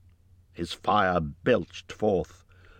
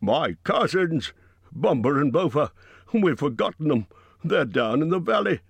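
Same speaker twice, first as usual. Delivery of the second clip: higher, alarmed cry for the lost cousins; a touch of despair in the sudden remembering; dismayed cry; 6 semitones higher, 3 dB louder than usual. A narrator's reading. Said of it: "Bifur gave a cry".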